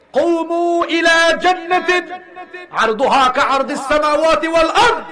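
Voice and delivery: high, loud and slow, stretched out; rousing call. Acted. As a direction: summon them toward paradise. Prophet calls to paradise.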